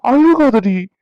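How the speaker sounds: loud, high exasperated plea; pleading. Acted. Husband begs his crying wife loudly and high. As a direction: raise your voice, begging her to stop.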